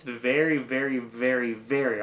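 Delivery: "very" repeated with each one leaned on; emphasizing very. Real, not acted. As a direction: stress every "very" as you repeat it. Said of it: "I'm gonna emphasize that word".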